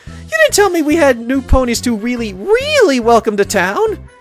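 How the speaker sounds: high, rushed, excited cartoon pony; Pinkie Pie. Pinkie with a newcomer to welcome, overexcited. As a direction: bubble over, high-pitched and tumbling through the list. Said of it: "a look of unbridled excitement and happiness".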